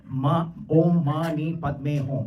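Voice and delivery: half-speed, drawn-out syllables; slow mantra recitation. Shown as a demonstration. Speaker showing a Buddhist six syllable mantra, slowly intoned.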